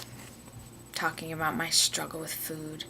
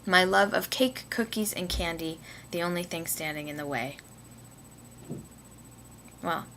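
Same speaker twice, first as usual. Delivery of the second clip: ordinary talk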